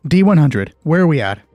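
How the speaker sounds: ordinary talk, nothing marked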